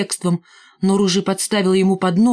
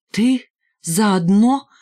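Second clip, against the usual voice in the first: scornful disbelief; 3 semitones higher, 3 dB louder, much slower than usual. A narrator's reading. Scornful confrontation of Валька.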